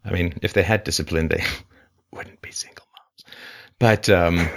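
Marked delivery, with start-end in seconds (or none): 1.8-3.4 laughing whisper. Real, not acted. Joke laughed under breath.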